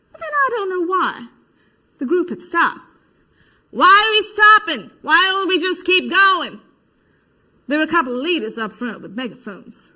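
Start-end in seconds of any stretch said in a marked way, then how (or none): 3.7-6.6 loud, high questioning shout; calling out. Acted. Marcher to the stopped group, calling out loudly. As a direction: holler it over the crowd.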